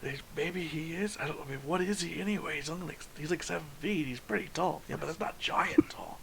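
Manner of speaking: whispering dwarves. Acted.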